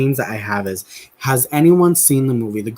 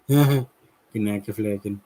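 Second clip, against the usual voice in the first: laughing mumble; 3 semitones lower, 4 dB quieter, much slower than usual. Retold or imitated. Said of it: "I was laughing last night".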